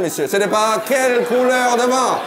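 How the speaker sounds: ordinary delivery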